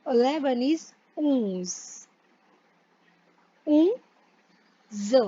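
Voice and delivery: "ऊंस" slow and nasal through the nose; nasal onze. Shown as a demonstration. Teacher slowly modelling nasal onze. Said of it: "nasal sound है फिर से".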